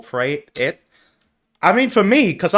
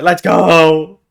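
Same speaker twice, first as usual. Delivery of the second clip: loud, drawn-out hype shout; excited cheer; 3 semitones higher, 6 dB louder than usual. Real, not acted. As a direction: cheer at the good news. Cheers at good news.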